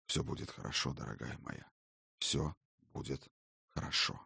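low, soft, soothing father; reassuring. Acted. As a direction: hush her gently. Father comforts crying daughter.

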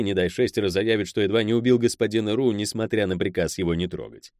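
ordinary delivery, nothing marked

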